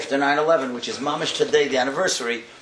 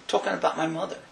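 usual speaking voice

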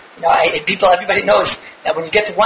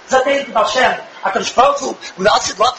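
ordinary talk, nothing marked

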